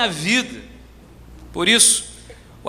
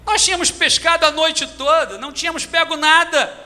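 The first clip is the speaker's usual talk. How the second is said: higher-pitched, exasperated protest; 8 semitones higher than usual. Acted. Tired fisherman answering the order to cast nets, protesting. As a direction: object in a raised pitch, steadily insistent.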